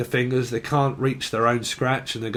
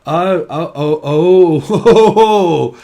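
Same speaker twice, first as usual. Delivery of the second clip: loud rising moans, laughing through them; mock moaning oh oh; 4 semitones higher, 10 dB louder, slower than usual. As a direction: ham up a stretched-out moan, slowing down.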